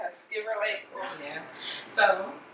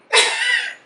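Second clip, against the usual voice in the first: very high squealing laugh; 4 dB louder than usual. Real, not acted. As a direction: let out a high shriek of laughter. Laughing after Zaddy joke.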